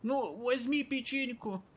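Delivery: high, slow, hoarse granny voice; wheezing old lady pushing a cookie; raspy old woman. Acted. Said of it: "весьма сильно хрипит".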